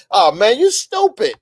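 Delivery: loud, high-pitched heckle; play-acted yell of a worked-up viewer; yelling at the screen. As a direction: holler in mock exasperation at the TV. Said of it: "yell at the screen like".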